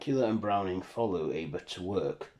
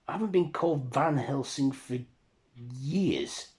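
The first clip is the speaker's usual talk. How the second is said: high, louder exasperated protest; acted put-out insistence; indignant correction; 6 semitones higher, 4 dB louder than usual. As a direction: correct the stranger in a raised voice. Ava indignantly corrects her name.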